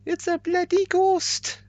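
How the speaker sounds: high, loud Welsh police shout; Welsh accent. Pretend. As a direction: bark orders like a Welsh copper. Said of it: "you have to do it in the Welsh accent".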